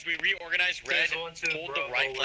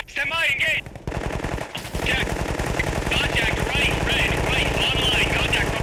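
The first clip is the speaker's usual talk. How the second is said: shouted contact callout; 10 semitones higher than usual. Real, not acted. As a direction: bark out the contact warning. Squad leader calls contact.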